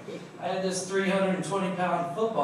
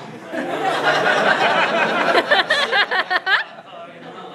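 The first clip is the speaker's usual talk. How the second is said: a long high cackle of laughter; 14 semitones higher, 7 dB louder than usual. Real, not acted. Long laugh at own story.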